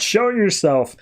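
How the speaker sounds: half-hearted call to someone hiding; mock yell. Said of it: "He just yells out".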